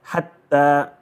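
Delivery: Hatta with the t doubled and held; hat-ta with a double consonant; emphasized letter. Shown as a demonstration. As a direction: pause on the t in Hatta before releasing it. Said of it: "because it is emphasized".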